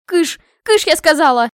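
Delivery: high, insistent command; shooing shout. Acted. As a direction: shoo them off loudly and crossly. Princess shooing the beggar away.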